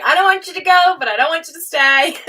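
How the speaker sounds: high, loud, half-crying whine; a hammed-up tearful plea to friends leaving; mock whiny wail. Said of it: "I'm like".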